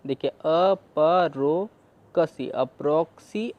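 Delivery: अपरो कसी slow, then joined as अप्रोक्सी; slow, then merge the chunks; blending syllables. Shown as a demonstration. Teacher blending chunks into one word slowly. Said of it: "क सी".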